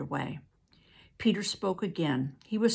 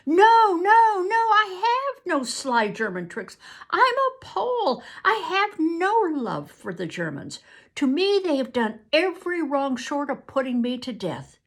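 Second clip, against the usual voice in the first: loud, much higher desperate denial; convincing plea; 9 semitones higher, 7 dB louder than usual. Acted. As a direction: cry out that you are innocent. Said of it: "He spoke convincingly".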